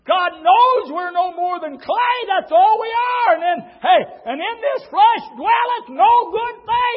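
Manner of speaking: slow, loud, high preacher's cry; preaching at full force. Acted. Preacher crying out to the church. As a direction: build it up and thunder it.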